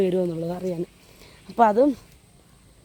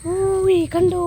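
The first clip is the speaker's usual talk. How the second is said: high excited call-out, a bit louder; pitched-up shout of discovery; 9 semitones higher, 4 dB louder, much slower than usual. Real, not acted. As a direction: call out, thrilled to spot it. Spotting the tank, calling out.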